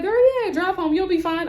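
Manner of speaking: high-pitched imitation of an encouraging friend. Coworker in the break room urging her to drive home, high-pitched encouragement. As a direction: mimic a friend's high, breezy go-ahead.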